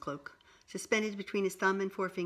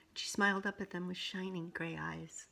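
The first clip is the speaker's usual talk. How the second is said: ordinary delivery, nothing marked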